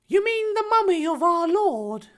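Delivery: very high, shocked character voice; shrieky-high, appalled boy; scandalised demand. Acted. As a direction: demand it in utter mock scandal. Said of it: "Harry demanded in scandal".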